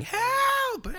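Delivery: high, yelled call for help; screaming for help. Retold or imitated. Fisherman yelling for help at night, high shout. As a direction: yell for help across the dark pond. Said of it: "And I just start screaming".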